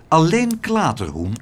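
ordinary delivery

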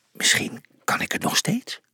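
breathy, wondering whisper; tentative private thought; hushed wondering. A narrator's reading. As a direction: whisper it to yourself, pitch lifting. Character wonders privately about himself.

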